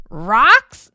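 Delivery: loud, rising puzzled cry; puzzled squeal. Acted. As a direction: squeal it like a baffled mother. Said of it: "Ma squealed in puzzlement".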